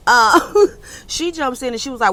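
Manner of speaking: usual speaking voice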